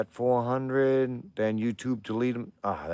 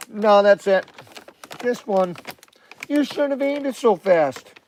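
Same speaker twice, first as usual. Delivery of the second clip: a high, louder teasing voice; high put-on voice; 11 semitones higher, 6 dB louder than usual. Teasing his dogs about treats in a high voice.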